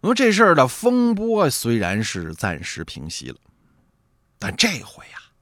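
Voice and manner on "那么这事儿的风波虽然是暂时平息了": plain reading voice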